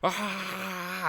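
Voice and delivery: long, high-pitched squeal held back in volume; mock happy scream. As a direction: imitate an overjoyed kid screaming from afar. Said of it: "screaming like".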